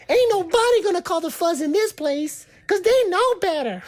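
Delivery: irritating, very high, loud voice; Tom Cruise. Retold or imitated. Imitating Steve in The Outsiders, irritating high voice. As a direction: squeal it as an exaggerated imitation. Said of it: "He had that irritating high voice".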